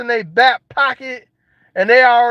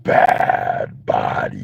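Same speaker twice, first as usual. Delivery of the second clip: very low, slow, voiceless rasp; mock raspy smoker croak; wrecked smoker voice; much slower than usual. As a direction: rasp it out like burnt-out lungs. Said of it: "Now you sound like this".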